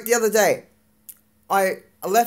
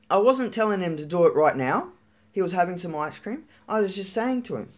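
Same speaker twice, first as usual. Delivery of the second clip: ordinary talk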